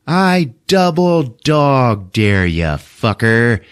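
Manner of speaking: high, slow, deliberate dare; mock challenge. Schoolboy escalating a dare, slow mock challenge.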